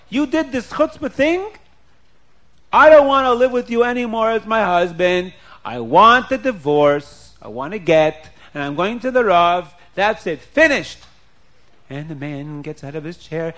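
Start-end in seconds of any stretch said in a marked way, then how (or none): 0.1-11.0 loud acted tirade, high start and emphatic finish; furious wife demanding a divorce, hammed-up; angry ultimatum. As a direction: declare it over, loudly and firmly.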